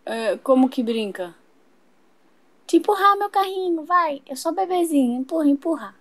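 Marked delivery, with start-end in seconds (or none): 2.7-5.9 put-on high little-girl baby talk. Little girl asking to be pushed in her stroller, high baby voice.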